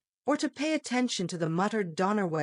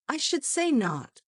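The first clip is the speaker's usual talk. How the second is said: emphatic denial, pitched up; 8 semitones higher than usual. A narrator's reading. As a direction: firmly deny it. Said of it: "said Fleck emphatically".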